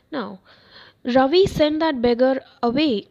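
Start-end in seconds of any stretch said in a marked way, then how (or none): 1.2-3.0 louder call from the back veranda, a mother calling her son; mother crying out. Acted. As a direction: call it out across the house to Ravi. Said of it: "cried his mother from the back veranda".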